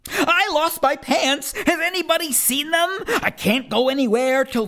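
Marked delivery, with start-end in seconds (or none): 0.1-1.5 alarmed. Acted. Boy who lost his pants, alarmed and higher. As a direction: cry it out like a panicked kid. Refrain said again.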